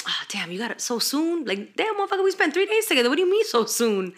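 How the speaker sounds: playful mock complaint. Acted quote to a partner.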